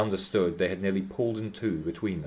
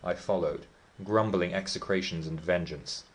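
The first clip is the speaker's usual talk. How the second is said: ordinary delivery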